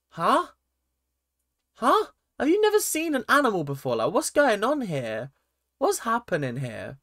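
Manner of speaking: high-pitched, incredulous mock reaction; utterly thrown, voice leaping up; bewildered husband. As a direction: act out baffled disbelief, swooping upward.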